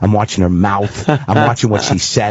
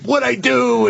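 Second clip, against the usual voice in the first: panicked inner voice; 11 semitones higher, slower than usual. Retold or imitated. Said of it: "in my head".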